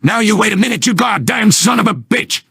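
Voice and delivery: high, furious yell at his rival; angry outburst. Acted. Engineer yelling at Soldier mid-argument, furious. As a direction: explode at the insult.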